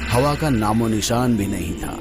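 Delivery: ordinary delivery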